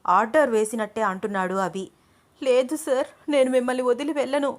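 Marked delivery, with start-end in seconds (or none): 2.4-4.5 high, pleading near-cry; narrator's lightly acted crying; tearful refusal. A narrator's reading. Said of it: "ఏడుస్తూ అంటోంది అను".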